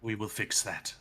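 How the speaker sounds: softly voiced cultist; quiet, understated menace from the guide; eerie cultist figure.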